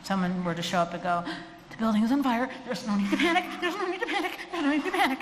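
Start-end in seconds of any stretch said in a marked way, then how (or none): 1.7-5.2 high, rising, panicky calm-down; hammed-up panic while saying don't panic; fake-calm fire warning gone shrill; mock alarmed announcer. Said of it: "you're gonna panic".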